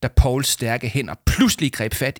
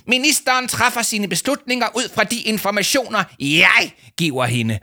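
much higher, bellowed rant; angry shouting; 11 semitones higher, 3 dB louder than usual. A narrator's reading. Adviser throwing his water bottle, angry high shouting. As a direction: yell it while hurling the bottle. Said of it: "råbte Leo Manchot".

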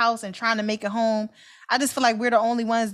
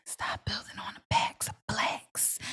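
hushed, voiceless whisper; whispered slogan; 10 dB quieter than usual. Pretend. Closing the show with the slogan, whispered. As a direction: whisper the sign-off like a secret message. Whispered first take of sign-off.

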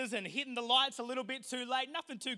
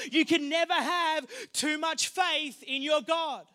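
preaching at full force; 4 semitones higher, 8 dB louder than usual. Acted. Pastor proclaiming faith in God to the church, loud. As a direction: preach it out to the back row.

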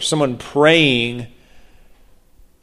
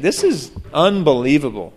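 ordinary delivery

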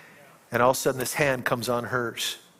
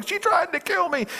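panicked cry. Retold or imitated. Bloodied man running from her.

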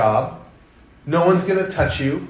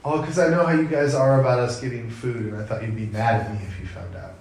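put-on soft, fast, timid kid explaining; sheepish child; 5 semitones lower, 5 dB quieter than usual. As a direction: mumble the excuse like a shy child. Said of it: "why did you feel the need".

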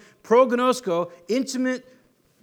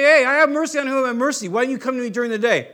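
fast, louder, brushing him off; mock dismissive God; 4 semitones higher, 5 dB louder, faster than usual. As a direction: rattle it off like a careless brush-off. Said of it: "He doesn't say".

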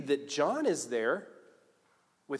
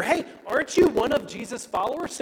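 lightly acted high, loud challenge; questioning accuser; 10 semitones higher, 5 dB louder than usual. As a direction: call out a suspicious question.

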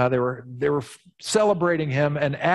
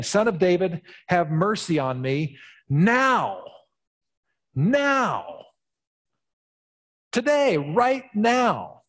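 higher, slow, insistent demand; bold demand; 5 semitones higher, slower than usual. Acted. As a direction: press him for mercy right now. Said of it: "I mean, it's bold".

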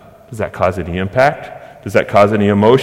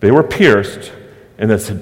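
normal talking voice

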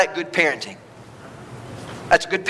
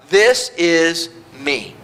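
slow; much slower than usual. Acted. God's slow emphatic claim.

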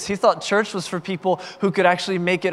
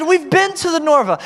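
high retold exclamation; excited realization; 10 semitones higher, 4 dB louder than usual. Girl excited she knows the venue, high-pitched. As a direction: pitch it up, thrilled.

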